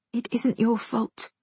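lightly voiced young woman, tender. A narrator's reading. Girl softly answers his apology.

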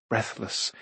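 plain reading voice

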